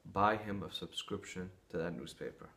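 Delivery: lightly voiced wise rabbi, gently suggesting; calm advice. Rabbi gives calm surprising advice.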